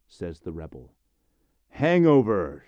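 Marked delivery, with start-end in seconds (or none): 1.7-2.6 loud high heckle; shout. Acted. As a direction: yell it from the back row. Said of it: "shouts the moralist from the back row".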